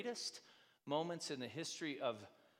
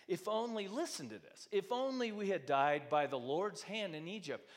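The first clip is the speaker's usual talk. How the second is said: higher, louder whiny complaining; grumbling complaint; 5 semitones higher, 5 dB louder than usual. Retold or imitated. Preacher voicing the Israelites grumbling against Moses, whiny. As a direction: whine it like a fed-up crowd. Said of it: "All the people are grumbling against them".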